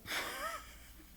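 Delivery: very high short squealing laugh; high squeal of laughter. Real, not acted. Squealing laugh at the boss's punchline.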